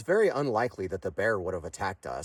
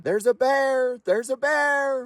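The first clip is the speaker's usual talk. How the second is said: high-pitched urgent yell, stretching out bear; shouting a warning; 13 semitones higher, 7 dB louder, slower than usual. A narrator's reading. Car passenger hanging out the window yelling about a bear, urgent shout. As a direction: holler it loud and high to warn everyone. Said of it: "still shouting".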